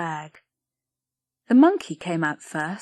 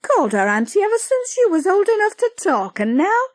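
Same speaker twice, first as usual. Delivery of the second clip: high, loud, fast rant; mother scolding the girl; exasperated mother; 12 semitones higher, 7 dB louder, faster than usual. Acted. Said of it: "cried Patty".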